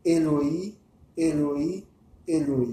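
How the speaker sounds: "Eloi, Eloi, Eloi", first two rising, last falling; recited invocation. Shown as a demonstration. Teacher shows listeners what to say before riding, recited invocation. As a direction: say "Eloi" three times, separated, drawing up the end until the last drops. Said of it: "vous dites".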